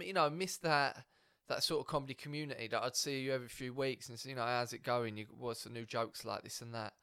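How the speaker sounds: ordinary talk, nothing marked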